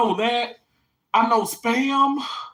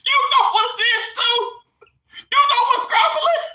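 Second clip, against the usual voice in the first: shocked disbelief; faster than usual. Real, not acted. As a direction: fire it off in disbelief. Shocked a viewer knows it.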